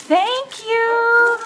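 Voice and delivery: high, drawn-out thank you; thrilled, pitch way up; excited thanks. Real, not acted. Host receives the giveaway basket, high excited thanks. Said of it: "I'm very excited".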